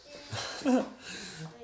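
high, airy burst of laughter; breathy laugh. Real, not acted. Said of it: "I'm laughing".

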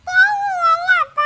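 put-on a little girl crying for her father. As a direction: cry it out to your dad. Child crying asks for father.

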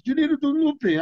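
imitating Jean-Claude pressing the point; light impression of an eager friend; Jean-Claude insisting. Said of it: "He goes".

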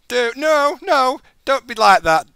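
loud, high desperate begging; panicked pleading. Real, not acted. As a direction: beg the seeker to back off. Pleading while caught.

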